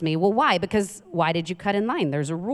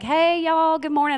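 a hint of a Southern good morning; Southern greeting; 8 semitones higher than usual. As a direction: greet like a friendly Southerner, a touch higher. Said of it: "people will come up and be like".

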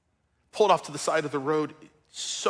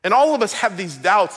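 ordinary talk

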